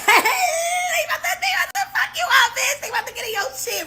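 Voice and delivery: very high squealing hype; gleeful falsetto jeer from the sidelines; hyped-up taunt. Retold or imitated. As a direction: squeal it like an excited instigator. Cheering on from the background.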